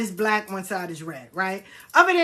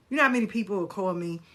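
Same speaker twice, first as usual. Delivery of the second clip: ordinary talk, nothing marked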